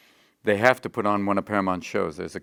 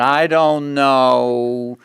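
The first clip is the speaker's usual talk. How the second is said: higher, louder and slow, a nervous producer's voice; Gary Marshall impression; 5 semitones higher, 7 dB louder than usual. Producer refusing the alien idea, higher, louder and slow. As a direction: stretch out the doubtful network boss. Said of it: "when Gary went".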